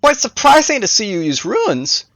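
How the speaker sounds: high-pitched villain puzzling aloud; musing Necromancer. A narrator's reading. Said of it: "began to muse aloud once more".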